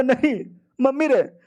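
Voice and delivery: stunned disbelief; shocked wail. Acted. Rival sees hero's money, high dismayed cry.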